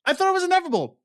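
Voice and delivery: very high, rising indignant squeal; mock betrayed shriek; outraged disbelief. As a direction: protest in shocked disbelief. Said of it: "I'm like".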